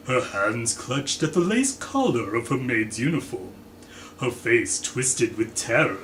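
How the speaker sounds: plain reading voice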